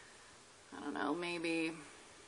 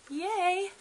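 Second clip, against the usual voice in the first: excited cheer; 12 semitones higher, 4 dB louder than usual. Real, not acted. Excited at new lizard.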